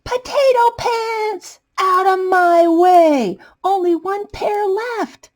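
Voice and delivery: high, slow desperate wail; high drawn-out panicked yell; frantic cry. Acted. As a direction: cry out like the last pair is slipping away. Potato rushes for last pants.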